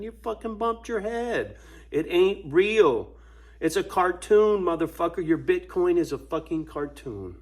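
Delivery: high, loud, exasperated tirade; real heated rant. Real, not acted. Ranting at Bitcoin believers about their cartoon money, heated and loud. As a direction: rant at them, pitch and volume up.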